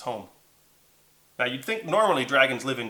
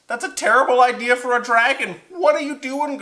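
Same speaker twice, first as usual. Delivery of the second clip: high, loud telling-off; raised, high-pitched reproach; exasperated scolding; 10 semitones higher, 7 dB louder than usual. Acted. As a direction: scold him in disbelief. Liam scolds the dragon.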